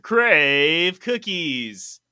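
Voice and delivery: loud, drawn-out sponsor hype; hyped sponsor shout. Acted. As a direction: belt the brand name with big excitement. Hyped sponsor name chant.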